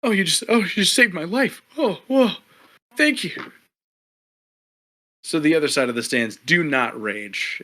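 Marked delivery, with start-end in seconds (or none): 0.0-3.5 much higher, louder, breathless relief; gasping thanks to his rescuer, overwhelmed; grateful rescued man. Acted. Rescued fighter thanking the party, high gasping relief. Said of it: "he's like".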